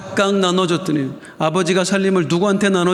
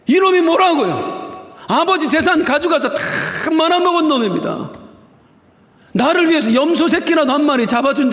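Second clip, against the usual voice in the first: angry elder son; 9 semitones higher than usual. Acted. Elder son protesting to his father over the feast, furious and high. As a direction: hurl the complaint at the father, voice pitched way up. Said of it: "화를 냅니다".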